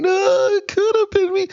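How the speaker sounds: very high whimpering mock cry; fake-sob like a whiny little girl; hammed-up little girl crying. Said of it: "You sound like a little girl crying".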